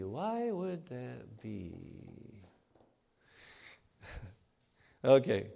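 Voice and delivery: ordinary talk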